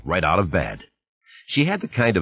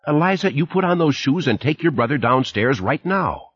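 mother scolding; 8 semitones higher than usual. A narrator's reading. Mother ordering child.

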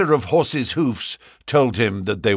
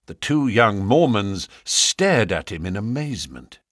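usual speaking voice

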